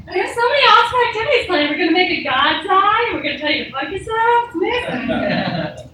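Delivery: fast, high, peppy camp counselor. Pretend. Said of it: "I sound like a camp counselor".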